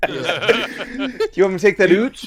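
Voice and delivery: put-on slow Canadian accent, "oot"; teasing Canadian with "oot".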